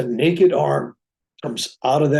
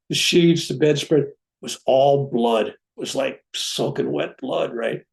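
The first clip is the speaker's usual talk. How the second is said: ordinary talk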